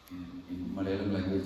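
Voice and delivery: normal talking voice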